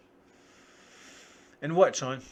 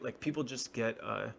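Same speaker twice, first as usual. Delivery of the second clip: normal talking voice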